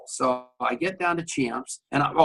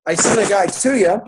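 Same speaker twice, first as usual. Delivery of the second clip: same line again, louder and higher; louder repeat; 6 semitones higher, 8 dB louder than usual. Retold or imitated. Boasting to a friend on the payphone, louder and higher. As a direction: push it out like proud news.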